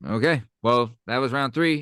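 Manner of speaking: normal talking voice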